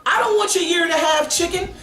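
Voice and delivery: very high-pitched comic protest; mock indignation. Diner refusing old freezer chicken, mock indignant.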